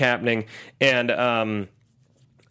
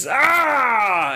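battle cry; 8 semitones higher, 3 dB louder than usual. Retold or imitated. Aussies charging into battle.

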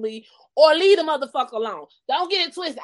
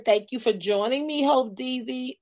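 usual speaking voice

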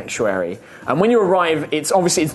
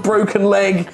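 normal talking voice

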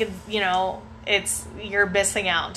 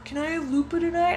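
higher, quick kid's request, lightly voiced; imitated pleading kid; 9 semitones higher, faster than usual. Kid asking parent for the dog at night, lightly voiced pleading. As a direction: lightly mimic a bargaining child.

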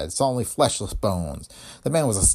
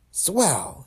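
a touch of harsh; 8 semitones higher than usual. Acted. Dame harshly taking in the news. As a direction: spit it out harshly. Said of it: "she said harshly".

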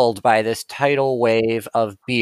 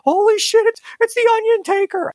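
freaking out. Acted. Tavern patron spotting the Onion Taker, starstruck shriek.

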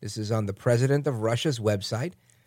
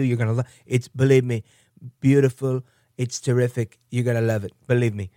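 Trump-style pitch; 3 dB louder than usual. Retold or imitated.

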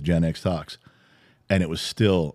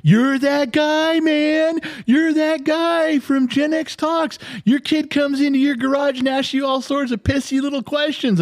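imitating the clerk, squeaky high and slowed; starstruck fan. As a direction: gush like a fan meeting a celebrity. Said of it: "He goes".

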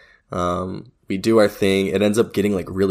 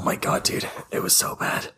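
whispering; 6 dB quieter than usual. Real, not acted. Whispered recounting.

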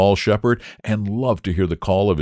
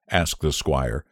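ordinary delivery, nothing marked

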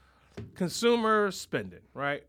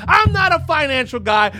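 yelled much higher and louder; comic shout; 9 semitones higher, 12 dB louder than usual. Acted. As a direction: bellow the disclaimer in mock alarm. Comic rant, escalating shout.

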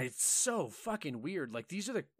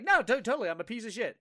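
higher-pitched, exaggerated self-blame; mock agreement; 8 semitones higher than usual. Player placating an accuser, mock self-deprecating. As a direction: agree too eagerly, pitched up. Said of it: "just start agreeing with them".

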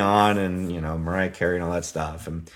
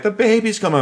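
urgent wife call; 12 semitones higher, 5 dB louder, faster than usual. Retold or imitated. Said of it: "She's like".

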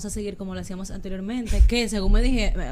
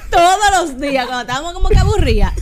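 talking through laughter, loud and high; giggling through the memory; laughing; 4 semitones higher, 6 dB louder than usual. Real, not acted. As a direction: spill it out while laughing. Laughing while recalling voice notes.

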